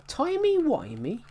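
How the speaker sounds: high, drawn-out incredulous question; John Hurt. Acted. Gamer quoting a favourite Doctor Who line, incredulous. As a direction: stretch it out with pitch shooting up. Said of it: "I love John Hurt's line".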